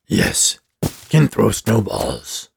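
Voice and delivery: a simple creature, slow and deliberate; slow snowman voice. Acted. Snowman agrees to throw snowballs, slow gravelly rasp.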